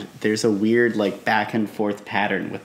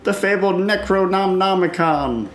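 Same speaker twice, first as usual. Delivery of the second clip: booming warrior king; 8 semitones higher than usual. Acted. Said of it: "you must make it a more regal voice".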